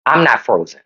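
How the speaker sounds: low, deadpan return from a freeze; Mitch McConnell. Retold or imitated. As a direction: imitate a senator coming out of a stall. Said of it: "that's when you go Mitch McConnell".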